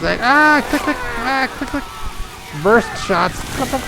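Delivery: hammed-up shutter sounds; camera click imitation. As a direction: mimic a phone camera firing burst shots. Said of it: "she was still taking pictures".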